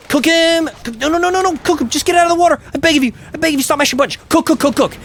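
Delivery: high-pitched, panicked commands; frantic urging. Real, not acted. Player begging Ryu to cook the boss, frantic.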